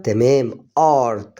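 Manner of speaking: art said slowly, open a and a long emphatic r; slow word demo. Shown as a demonstration. Teacher blending the word art for beginners, slowly. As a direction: stretch out art so the learner hears every sound. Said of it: "تبقى الكلمة على بعضها".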